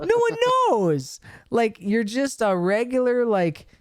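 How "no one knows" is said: high, excited exclamation. Real, not acted. Laughing outrage at the manager.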